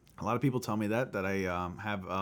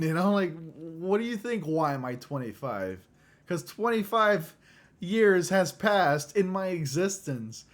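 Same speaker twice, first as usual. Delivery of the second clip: loud, high ranting over the question; exasperated rant; 7 semitones higher, 6 dB louder than usual. Real, not acted. Venting about a classmate's question on his age, loud exasperation. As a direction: vent the irritation, half laughing. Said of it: "such a stupid goddamn question".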